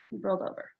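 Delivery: ordinary talk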